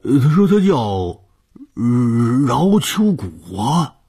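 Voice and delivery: put-on old man repeating a name, high and loud; hesitate, then draw the name out loudly; flustered old servant. (老仆人高声拖长地回答)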